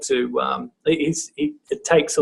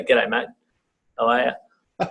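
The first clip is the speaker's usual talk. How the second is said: low, offhand blokey greeting; talking to a male student; 4 semitones lower, 3 dB quieter, faster than usual. Shown as a demonstration. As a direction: greet a bloke casually, pitched down. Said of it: "the male student and you say".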